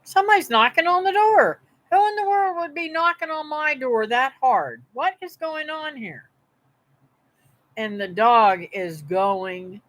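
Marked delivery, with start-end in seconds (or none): 0.0-6.3 higher-pitched, startled inner voice; alarmed surprise. Retold or imitated. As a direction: retell your alarm at the hard knocking. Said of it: "And I thought".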